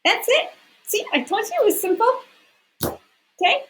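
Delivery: high, quick playful tease. Real, not acted. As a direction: toss it off brightly, way up high.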